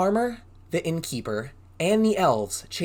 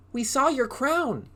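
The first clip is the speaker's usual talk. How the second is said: goblin crowd; 11 semitones higher, slower than usual. Acted. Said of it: "said the other goblins".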